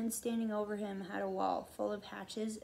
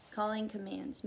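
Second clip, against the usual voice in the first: plain reading voice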